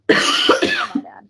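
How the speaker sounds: coughing on a drink; choking on wine, sputtering. Real, not acted. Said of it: "I'm choking on my wine".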